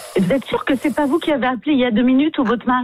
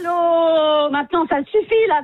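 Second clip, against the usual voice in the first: higher, fed-up outburst; exasperated; 4 semitones higher than usual. Real, not acted. Receptionist tired of prank calls, exasperated.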